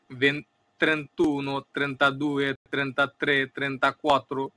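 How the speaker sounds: Italian numbers read out slowly, one by one; Italian counting. Shown as a demonstration. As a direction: count trentuno to trentaquattro like a teacher. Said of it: "তিরিশের পরও যথারীতি ওরকম". (ইতালীয় উচ্চারণে ধীরে গোনা)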